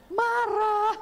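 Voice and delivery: high, sharp one-word retort; hammed-up angry answer; mock angry retort. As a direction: snap it back like the wife. Wife's retort to husband.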